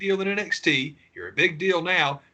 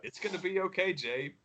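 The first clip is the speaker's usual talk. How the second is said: mock reassurance; 5 semitones higher than usual. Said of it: "It's like".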